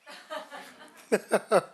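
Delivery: high, loud real laughter. Real, not acted. Teacher to the audience before welcoming them, laughing loudly. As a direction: break into a big high laugh.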